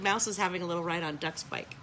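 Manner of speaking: ordinary delivery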